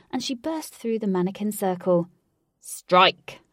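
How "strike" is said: loud one-word battle cry; shout. A narrator's reading. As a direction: shout it as the blow lands. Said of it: "Alec shouted".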